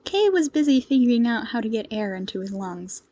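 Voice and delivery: ordinary delivery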